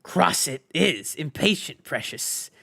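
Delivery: slow hissed creature voice, fading to a hiss; hissing Gollum. A narrator's reading. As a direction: hiss it out slowly, sulking. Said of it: "hissed Gollum".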